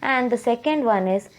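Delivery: normal talking voice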